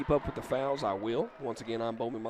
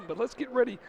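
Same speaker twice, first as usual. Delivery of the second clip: play-by-play call; 5 semitones higher than usual. Acted. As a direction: call the action to the crowd.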